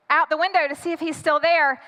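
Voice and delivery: normal talking voice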